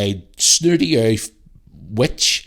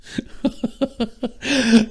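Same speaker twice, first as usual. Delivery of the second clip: high burst of laughter. Real, not acted. Laughing off the rant.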